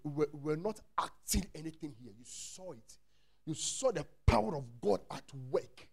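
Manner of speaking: ordinary delivery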